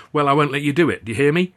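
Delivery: heated confrontation; angry. A narrator's reading. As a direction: square up and shout him down. Squaring up to Charlie.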